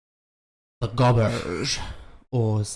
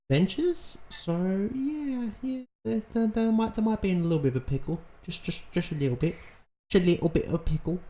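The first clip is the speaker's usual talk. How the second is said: high, fast, teasing sing-song; 9 semitones higher, 4 dB quieter than usual. Acted. Viewer teasing the characters about their trouble, high sing-song. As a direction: tease them about being in a pickle.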